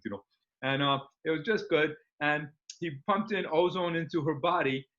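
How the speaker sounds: ordinary delivery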